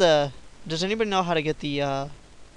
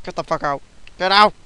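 louder, rising hammed-up eviction cry; haunted armor voice; 3 semitones higher, 6 dB louder than usual. Gamer voicing the haunted armor ordering him out, hammed-up yell. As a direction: play the angry armor kicking them out. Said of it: "It's like".

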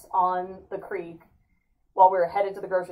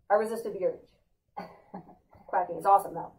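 ordinary talk

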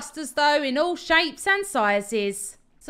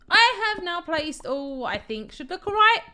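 ordinary delivery

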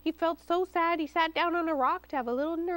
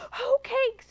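high excited squeal, louder; 12 semitones higher, 5 dB louder than usual. A narrator's reading. Hungry pig finds his lunch, high squeal. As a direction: squeal with greedy joy. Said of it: "he squealed".

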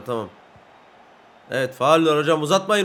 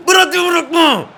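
very high, full-force yell; real scream; 12 semitones higher, 9 dB louder than usual. Real, not acted. Player screams at defender to hold on, all-out shout. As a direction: scream the order at the top of your voice. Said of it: "Öyle bağırınca tuttu bak".

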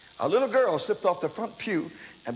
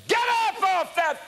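much higher, loud rebuking yell; preacher rebuking a demon, shrieked loud and high; commanding scream; 5 dB louder than usual. Retold or imitated. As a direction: cast it out with a sudden high shout. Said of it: "I screamed".

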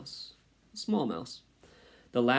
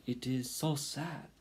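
put-on high, slow French-accented lament; sighing French mother; 5 semitones higher than usual. A narrator's reading. Mother mouse laments her only baby, sighing French accent. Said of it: "She sighed".

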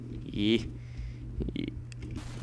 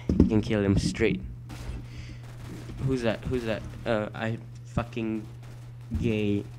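normal talking voice

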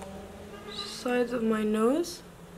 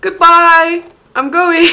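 loud, high shouted goodbye; excited mock farewell; 7 semitones higher, 16 dB louder, slower than usual. Vlogger pretending to leave in delight, excited shout. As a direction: shout a playful dramatic exit. Mock exit, overwhelmed by highlight.

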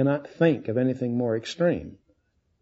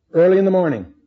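raised, louder stress on "Early"; emphatic repeat; 6 semitones higher, 5 dB louder, faster than usual. Shown as a demonstration. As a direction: EARLY hit high and loud.

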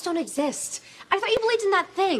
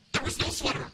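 whisper; 7 dB quieter, faster than usual. Acted. Scary story climax, hushed.

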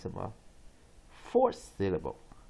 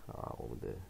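ordinary delivery

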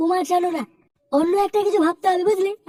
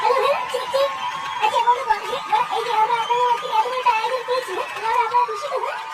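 higher, excited stage speech into a microphone; mic check speech; 6 semitones higher than usual. Acted. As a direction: test the mic then rouse the crowd. (Mic test করে উত্তেজিত মঞ্চ-ভাষণ)

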